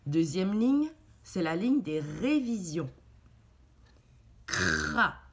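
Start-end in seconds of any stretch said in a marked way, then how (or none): 4.5-5.2 Cra with the r rolled low in the throat. Shown as a demonstration. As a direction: roll the r in Cra. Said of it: "Tu te rappelles ... consonnes qui roulent". (Cra, en roulant le r)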